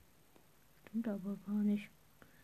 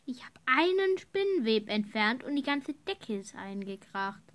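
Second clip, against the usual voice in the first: ordinary talk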